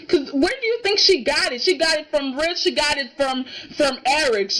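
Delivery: ordinary talk, nothing marked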